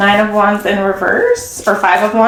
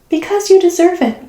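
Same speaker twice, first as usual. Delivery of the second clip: lilting, much higher commercial voice; ad slogan; 9 semitones higher than usual. Retold or imitated. Tarot reader quotes the eighties L'Oreal slogan, high and sing-song. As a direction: sell it like an eighties beauty ad.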